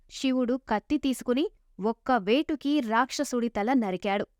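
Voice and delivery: ordinary delivery, nothing marked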